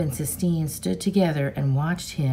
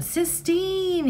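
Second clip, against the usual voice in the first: screamed call; 14 semitones higher than usual. A narrator's reading. Boy desperately calling the girl on the highway, screaming. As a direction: stretch the name out in a high mock scream. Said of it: "he screamed".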